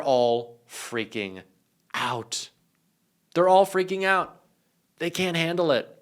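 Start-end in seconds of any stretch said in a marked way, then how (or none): 0.0-2.5 slow, stretched out with a pause before out; drawn-out. Acted. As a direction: lean on freaking and hang before out. Stretched first take of phrase.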